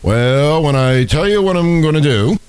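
drawn-out campaign-trail speech impression; Obama. Host mimicking him on the campaign trail, put-on accent. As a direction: stretch it out like a speechifying candidate. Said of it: "When he talked like this?".

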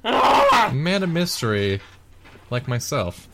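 slow, playful man-of-mystery delivery; mock mysterious. Joins the mock dramatic narration.

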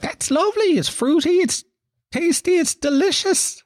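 high, sing-song gushing over wine; dainty mock rapture; mock delighted. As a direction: swoon over the fruity taste in falsetto. Mocking wine drinkers' delight.

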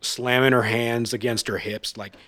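ordinary talk, nothing marked

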